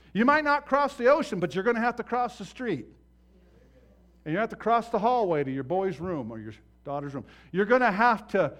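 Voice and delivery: loud, driving pulpit preaching; preaching at full force. Acted. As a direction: hammer each point home to the congregation.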